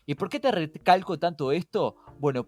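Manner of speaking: normal talking voice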